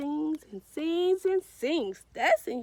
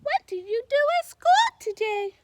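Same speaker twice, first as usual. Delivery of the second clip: quick, higher, louder mom's question; curious mom; 7 semitones higher, 6 dB louder, faster than usual. Retold or imitated. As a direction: ask it fast and eager like a mom. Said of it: "Pete's mom asks".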